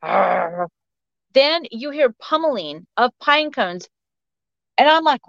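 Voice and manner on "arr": dog growl. Retold or imitated. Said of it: "you hear my dog go".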